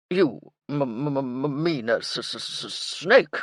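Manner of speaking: colonel stuttering on m and s; startled stammer. A narrator's reading. Colonel asked about a python, flustered stammering.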